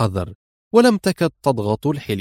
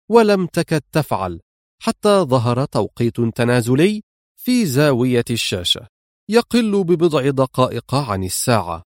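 ordinary delivery, nothing marked

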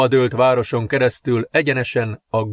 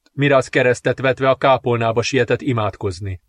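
ordinary delivery

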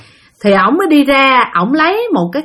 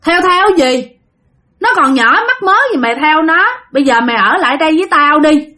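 high, loud yelling at the spirit; furious protective yell; outraged shout; 6 semitones higher, 3 dB louder than usual. Retold or imitated. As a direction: shout him down in disbelief. Possessed man shouting at spirit.

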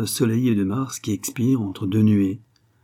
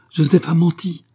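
indignant cry, higher and louder; violent outcry; 8 semitones higher, 6 dB louder than usual. A narrator's reading. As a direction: cry out the accusation with force. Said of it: "s'écria violemment Angèle". (cri violent et indigné)

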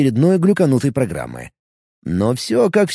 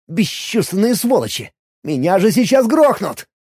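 higher, aggrieved complaining; indignant; 6 semitones higher than usual. A narrator's reading. Player cursing orcs who only watch, indignant. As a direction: rail at useless onlookers. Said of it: "негодовал я".

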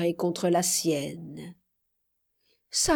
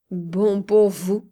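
slow, louder scornful echo; scornful cry; 4 dB louder, slower than usual. A narrator's reading. Man scorning her loyal masters, crying out. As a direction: throw her words back at her. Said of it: "s'écria Gabriel".

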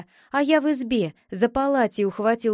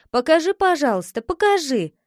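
higher, louder, hurried pleading; eager begging; 5 semitones higher, 4 dB louder, faster than usual. Acted. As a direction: beg quickly like an impatient little brother. Boy begs sister to show it.

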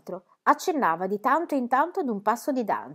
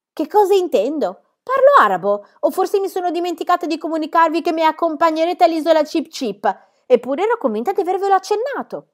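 louder, higher, mock-exasperated; playful loud retort; 8 semitones higher, 8 dB louder than usual. A narrator's reading. Pippi telling Tommy and Annika they're coming, mock exasperation. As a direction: tease them before the big news.

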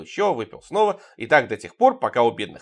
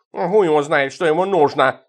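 loud, put-on cat voice in a rage; furious cat; 3 semitones higher, 6 dB louder than usual. A narrator's reading. Cat Tikhon loses his temper over Goshenka, furious. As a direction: say it loudly as an enraged cat. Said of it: "рассвирепел кот".

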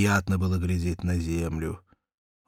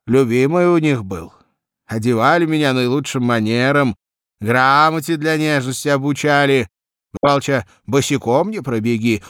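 put-on higher, garrulous young peasant storyteller; chatty cabman Vanka; 8 semitones higher, 9 dB louder than usual. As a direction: ramble fondly about being spoiled, pitched up. Vanka tells his childhood.

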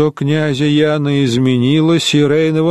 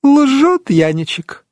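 slow, higher mocking smirk; 10 semitones higher, slower than usual. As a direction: sneer it with a smile. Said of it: "усмехнулась Бургунка". (насмешливо, с усмешкой, выше и медленно)

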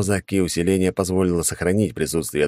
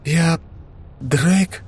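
высокий дрожащий голос, сквозь слёзы; неверящий, дрожащий от слёз вопрос; tearful vows; 12 semitones higher than usual. Acted. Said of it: "дрожащим голосом произнесла она". (дрожащим от слёз голосом)

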